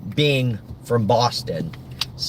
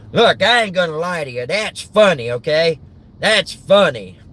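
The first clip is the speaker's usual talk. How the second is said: Larry the Cable Guy; 4 semitones higher than usual. Acted. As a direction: imitate a folksy Southern stand-up comedian. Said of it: "I remember what Larry the Cable Guy always says".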